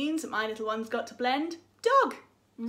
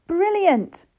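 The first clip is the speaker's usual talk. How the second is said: excited praise; 8 semitones higher, 7 dB louder than usual. Acted. Praising child's answer.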